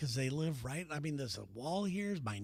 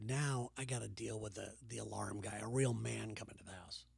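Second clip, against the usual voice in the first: softer, lower muttered thought; inner grumble; 7 semitones lower, 7 dB quieter than usual. Retold or imitated. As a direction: grumble it to yourself quietly. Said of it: "and I'm thinking".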